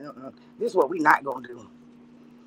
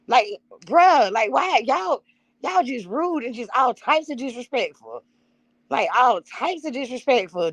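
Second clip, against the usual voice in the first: indignant; 6 semitones higher, slower than usual. Real, not acted. Scolding chat for rudeness.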